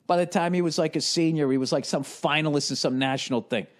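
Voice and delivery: ordinary talk, nothing marked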